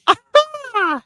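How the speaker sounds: high, loud mock squawk "Ah!"; crow-like catchphrase cry. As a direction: squawk it out, mimicking a wrestler's catchphrase. Imitates Ricochet's crow-like catchphrase.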